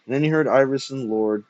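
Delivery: ordinary delivery, nothing marked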